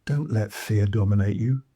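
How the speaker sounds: usual speaking voice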